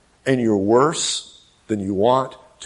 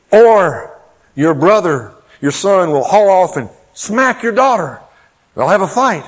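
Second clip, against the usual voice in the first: ordinary delivery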